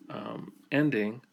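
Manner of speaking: usual speaking voice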